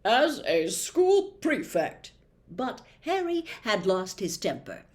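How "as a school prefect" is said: higher, louder, slow self-important voice; self-important prefect; pompous prefect. A narrator's reading. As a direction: pull rank pompously. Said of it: "Percy said".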